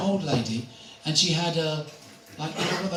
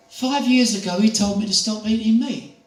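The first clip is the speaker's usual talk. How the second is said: put-on old lady voice; 6 semitones higher, 6 dB louder than usual. Elderly lady voiced in story.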